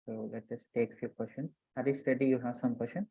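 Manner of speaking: very soft, far from the mic; speaking too quietly to hear; barely audible. Real, not acted. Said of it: "Your voice is very low".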